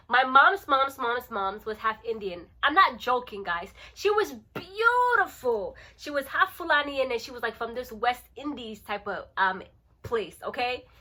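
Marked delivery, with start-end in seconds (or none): 4.5-5.8 BEAUTIFUL stretched out high and long; gushing emphasis. Real, not acted. Telling viewers about her great-grandmother's looks, gushing. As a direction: draw out "beautiful" in a high, loud swoop.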